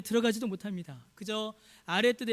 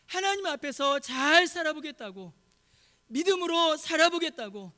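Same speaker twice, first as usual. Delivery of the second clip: rising, louder sermon climax, pressing the congregation; impassioned preaching; 5 semitones higher, 4 dB louder than usual. Real, not acted. Pastor pleading with the congregation, building to full force. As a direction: preach it louder and higher toward the end.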